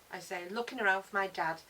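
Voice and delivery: plain reading voice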